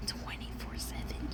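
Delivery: soft whispered encouragement; hushed whisper to yourself the night before. Acted. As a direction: whisper it like a secret pep talk. Pep talk to past self.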